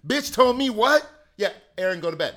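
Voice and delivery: loud phone gossip; gossiping mom. Retold or imitated. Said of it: "my mom's talking on the phone".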